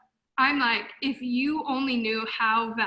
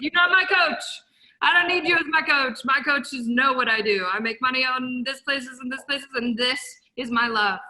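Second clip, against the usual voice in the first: loud, indignant telling-off; defiant retort; 5 dB louder than usual. Retold or imitated. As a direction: put them in their place. Retorting to would-be coaches.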